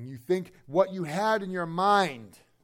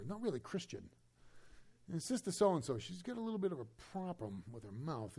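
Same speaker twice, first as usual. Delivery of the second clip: much softer, confiding sneer; gossiping judge; 9 dB quieter than usual. Retold or imitated. Preacher voicing a new believer judging others, soft and snide. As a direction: murmur it like church gossip.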